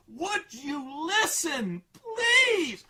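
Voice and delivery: high, slow wailing plea; tearful pleading. Retold or imitated. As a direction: cry out for him to listen. Girl begging, crying voice.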